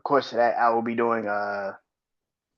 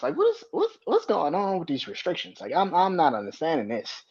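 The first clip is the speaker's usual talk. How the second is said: high-pitched, stammering disbelief; exasperated bafflement; 6 semitones higher than usual. Real, not acted. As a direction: squeak out your bewilderment at the rules. Exasperated rant about restrictions.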